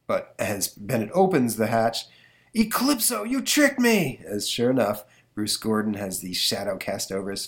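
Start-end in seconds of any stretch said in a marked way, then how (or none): 2.5-4.2 high, betrayed professor's cry; high outraged cry. Acted. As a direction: cry out at being tricked.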